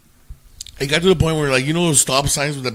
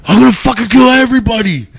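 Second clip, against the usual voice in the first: much higher and louder furious bellow; enraged roar; 12 semitones higher, 11 dB louder than usual. Retold or imitated. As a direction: roar it like a berserk drunk. Huge guy raging, heard from afar.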